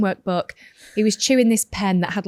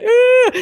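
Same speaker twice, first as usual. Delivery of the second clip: much higher, louder pained groan; imitated pained groan; 9 dB louder than usual. Mum imitating her bleeding son's groan. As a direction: groan like a hurt child. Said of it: "And he was going".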